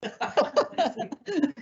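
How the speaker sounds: laugh. Real, not acted. Laughs at being asked.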